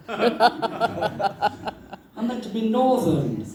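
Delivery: laughing it out slowly, high; slow, high laughing confession. Retold or imitated. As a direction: giggle through the admission. Laughing at own mistake.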